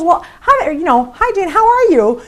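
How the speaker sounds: high, eager how-are-you; a friend keen to catch up; gushing friend. Retold or imitated. Friend answering the call, high and eager. Friend gushes back on phone.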